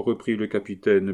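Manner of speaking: plain reading voice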